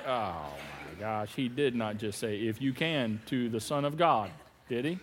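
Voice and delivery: low, soft gossiping aside; bystander's aside. Retold or imitated. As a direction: murmur it like a shocked onlooker. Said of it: "You know, like".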